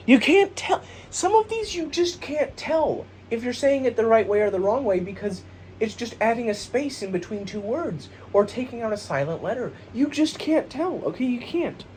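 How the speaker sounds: high, worked-up rant; frustrated squeal about spelling; exasperated. Real, not acted. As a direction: throw up your hands, voice way up. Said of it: "exasperated pitch jump".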